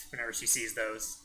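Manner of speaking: ordinary delivery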